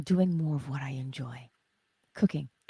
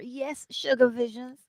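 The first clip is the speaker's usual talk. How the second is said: ordinary delivery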